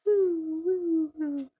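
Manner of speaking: high bird call. Real, not acted. Calling to the birds.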